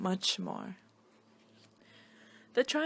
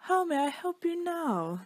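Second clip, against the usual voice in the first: much higher, helpful magical voice; shapeshifter voice; 8 semitones higher than usual. Acted. Shapeshifter greets the triangle, high and helpful. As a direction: sing it out like an eager wizard.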